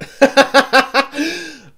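loud, high burst of laughter; hearty laugh. Real, not acted. Vlogger amused by her own code switching, loud laugh.

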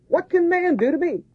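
high squeaky mock voice; mocking high squeak. Preacher mocking man's threat, squeaky high voice. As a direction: squeak it like a scornful little man.

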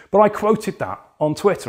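usual speaking voice

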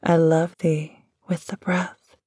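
ordinary talk

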